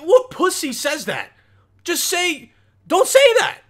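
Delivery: high-pitched, incredulous outburst; exasperated disbelief. Real, not acted. Reactor scolding guys who say that, exasperated. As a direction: squeal it in exasperation.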